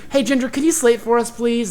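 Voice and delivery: high, put-on courteous request; polite director. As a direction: ask sweetly, masking your impatience. Director addressing auditioner aloud, politely.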